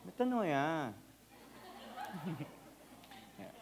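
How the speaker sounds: ordinary delivery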